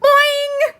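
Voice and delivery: high, loud comic boing; a playful yelled boing. A narrator's reading. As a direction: squeal it through a rubber mask.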